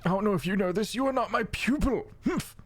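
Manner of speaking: high, huffy voice echoing the other's words; haughty fey mentor. Acted. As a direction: throw it back snootily, pitched high. Fey mentor mocks the player's words.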